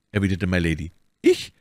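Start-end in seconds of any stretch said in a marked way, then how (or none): 1.2-1.5 high-pitched cry of surprise; startled exclamation. A narrator's reading. Novice answers Milady's accusation with a startled cry. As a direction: exclaim it, taken aback. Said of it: "rief die Novize".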